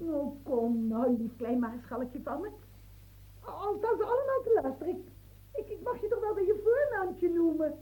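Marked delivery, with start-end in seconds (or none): 0.0-2.5 coaxing tenderness. Acted. Woman to the marshal, coaxing and tender. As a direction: sweet-talk him.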